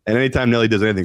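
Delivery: ordinary delivery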